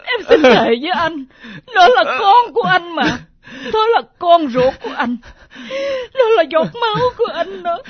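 loud, tearful swearing; a wife swearing to her husband the child is his, crying; sobbing plea. Acted.

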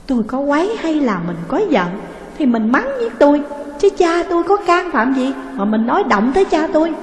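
indignant, pitch rising as she defends her father; trembling with anger. A narrator's reading. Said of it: "Cô Ba Mạnh giận run".

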